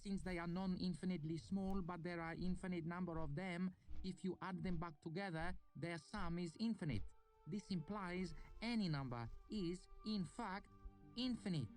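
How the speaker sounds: put-on high, careful Lanati accent; student Uresh explaining infinity in a Lanati accent; odd Lanati accent. A narrator's reading. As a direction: reason it out in a foreign Lanati lilt. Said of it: "in his odd Lanati accent".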